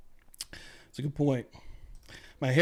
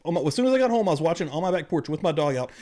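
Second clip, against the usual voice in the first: ordinary talk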